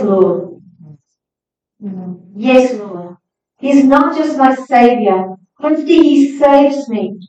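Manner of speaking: ordinary delivery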